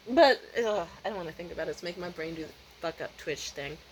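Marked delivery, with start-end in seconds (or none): none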